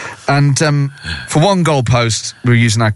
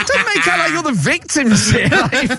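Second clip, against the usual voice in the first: higher, laughing indignant retort; mock outrage while laughing; an indignant retort to the old lady, laughing through it; laughing protest; 11 semitones higher than usual. Retold quarrel with the old lady.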